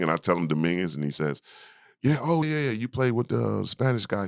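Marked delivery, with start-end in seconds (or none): 2.0-4.2 excited coach. Retold or imitated. Coach recognizes him, excited.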